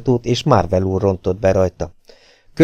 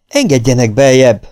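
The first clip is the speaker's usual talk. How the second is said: loud plea, pitched up; tearful begging; 4 semitones higher, 6 dB louder than usual. A narrator's reading. Fugitive pleads with the men inside, urgent begging. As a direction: beg to be let in, a touch tearful. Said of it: "rimádkodott könnyes szemmel Marvel".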